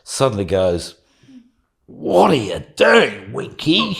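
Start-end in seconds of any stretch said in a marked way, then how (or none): 1.9-4.0 slow, higher, louder Australian indignation; mock Australian outburst. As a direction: scold him in a drawn-out Aussie accent. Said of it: "the mute Australian suddenly goes".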